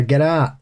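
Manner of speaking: "Get out" with a broad open vowel, "out" near "at"; own-accent pronunciation. Shown as a demonstration. Showing co-hosts how we say "Get out", open-vowelled. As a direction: demonstrate "out" with an open "ah". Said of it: "Whereas we'd say".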